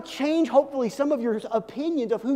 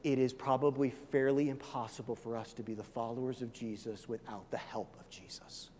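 hushed sincerity; 8 semitones lower, 9 dB quieter than usual. Real, not acted. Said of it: "I mean this with all the love".

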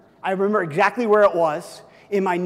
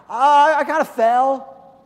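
put-on sheepish kid; 7 semitones higher, 7 dB louder, slower than usual. Sheepish boy answering mom.

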